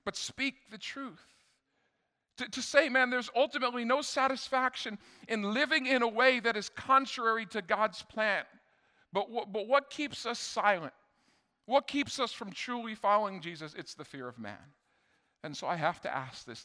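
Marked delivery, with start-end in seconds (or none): 2.4-13.7 high, loud, driving pulpit voice; fiery preacher lifting the congregation; preaching at full force. Acted. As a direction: preach it out to the whole room. Preacher's loud rising exhortation.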